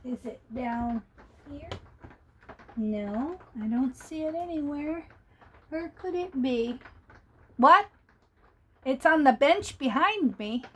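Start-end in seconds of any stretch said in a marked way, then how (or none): none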